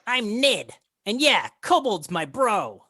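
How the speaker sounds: high, loud kobold voice; defiant kobold. Acted. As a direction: shout it proudly as a small, cheeky creature. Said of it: "this kobold says".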